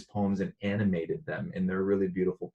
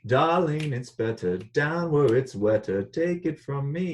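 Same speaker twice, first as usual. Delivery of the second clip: put-on Jamaican accent; 6 semitones higher, 3 dB louder than usual. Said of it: "I know I have to work on my Jamaican accent".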